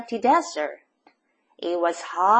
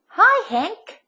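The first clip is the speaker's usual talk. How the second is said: high, louder hail to a friend; cheerful call; 11 semitones higher, 5 dB louder than usual. A narrator's reading. Tumbleweed greeting the cactus, calling out. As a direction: call out in a lifted, lively pitch. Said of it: "Rosie the tumbleweed called out".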